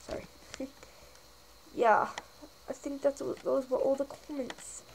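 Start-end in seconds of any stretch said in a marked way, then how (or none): none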